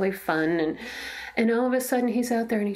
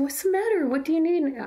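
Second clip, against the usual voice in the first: high-pitched, urgent mother's questions, retold; concerned pleading; 8 semitones higher than usual. Mother asking her crying son on the court, concerned pleading. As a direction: plead to know what is wrong.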